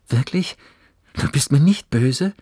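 light up with relief and hope; beaming. A narrator's reading. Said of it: "Johannes strahlte".

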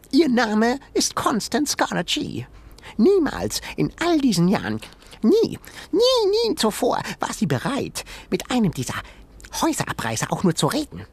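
high, indignant little animal voice; tiny creature voice. A narrator's reading. Sengi explaining the museum owner to Ben, high creature voice. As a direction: rant like an outraged critter.

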